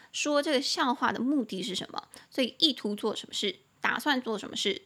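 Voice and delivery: ordinary talk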